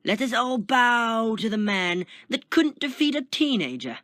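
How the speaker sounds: loud, high mocking proclamation; jeering shout. A narrator's reading. Prisoner mocks the beaten Taskmaster, jeering shout. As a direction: shout it to the whole cell block.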